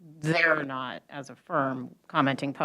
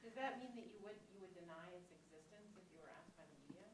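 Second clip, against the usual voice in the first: muffled behind a face mask; talking through a mask; 26 dB quieter than usual. Real, not acted. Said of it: "I can't quite hear you".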